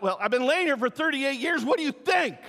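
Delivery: sarcastic lame man. Retold or imitated.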